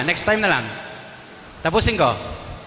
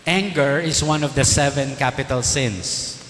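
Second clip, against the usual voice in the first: slow solemn statement; 2 semitones lower, slower than usual. Real, not acted. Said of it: "priest states doctrine solemnly".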